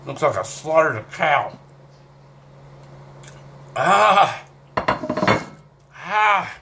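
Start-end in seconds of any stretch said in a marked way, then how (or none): 3.8-6.6 high open "ah" exhales; burning-mouth gasps. Real, not acted. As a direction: gasp out the heat, high. Gasping from the pepper burn.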